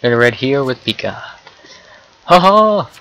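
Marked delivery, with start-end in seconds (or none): 2.2-2.9 loud, high gloating "ha ha"; mock-villain victory laugh; triumphant laugh. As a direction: crow over the win.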